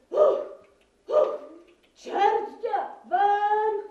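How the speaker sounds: huffs, then a loud drawn-out yell; yelling old man. Acted. Grandfather curses after being run off road, yelling. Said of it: "a křičí".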